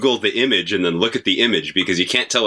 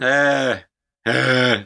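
two quick frightened cries; startled yelps; 5 semitones higher than usual. Real, not acted. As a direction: yelp as the spider crawls. Brushing off the spider.

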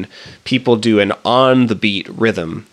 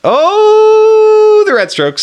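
swooning; 7 dB louder, much slower than usual. Pretend. Mock swooning reaction.

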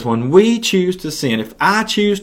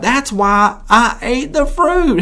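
high, pleading Eve blaming the serpent; whiny excuse; 10 semitones higher, slower than usual. Acted. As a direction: squeak out an excuse to God.

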